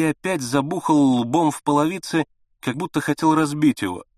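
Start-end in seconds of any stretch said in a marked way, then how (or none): none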